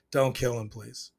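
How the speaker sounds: low, soft plea fading at the end; quiet begging. Retold or imitated. Viewer pleading for Joel's life, soft begging. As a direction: plead under your breath, imitating your own begging. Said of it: "I'm begging for his life too".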